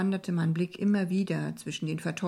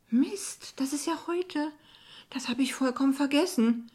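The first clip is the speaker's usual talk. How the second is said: high-pitched dismay at having forgotten; alarmed, much higher voice; sudden startled realisation; 7 semitones higher than usual. A narrator's reading. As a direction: blurt it out as it suddenly hits you. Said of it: "fiel es mir siedend heiß ein".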